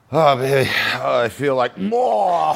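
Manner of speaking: high, slow, laughing mock moan; high, slow, giggling impression of a craving mom-to-be; moaning pregnant wife. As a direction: ham up her dreamy craving. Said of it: "and she'd go".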